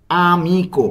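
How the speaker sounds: "আমিকো" drawn out slowly, syllable by syllable; careful pronunciation. Shown as a demonstration. Teacher has learners note the pronunciation, slow careful demonstration. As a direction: stretch each vowel of "আমিকো" for learners. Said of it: "উচ্চারণগুলো ভালোভাবে খেয়াল করব".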